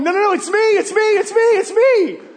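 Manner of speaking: the healed man shouting it's me over the crowd; excited insistence. Retold or imitated. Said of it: "they're going".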